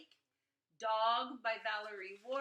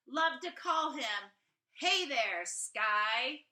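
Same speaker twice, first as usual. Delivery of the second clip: put-on louder, sing-song reading of a child's poem; 6 semitones higher, 8 dB louder than usual. A narrator's reading. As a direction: read it like a proud little poet, with a beat.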